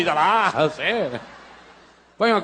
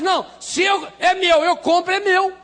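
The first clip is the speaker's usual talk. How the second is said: possessive; 9 semitones higher than usual. Retold or imitated. Pessoa possessiva falando.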